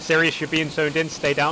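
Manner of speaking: urgent shout. Real, not acted. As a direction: yell it across the field. Warning teammate under fire.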